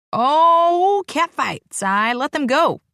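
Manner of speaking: high, loud excited call. Acted. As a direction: egg on the fight. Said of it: "Jesse calls out".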